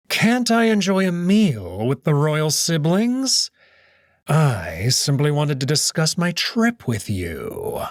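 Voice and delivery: slow, silky mocking host voice; sly Cato. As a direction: purr the welcome with false courtesy, drawn out. Cato answers Virginia.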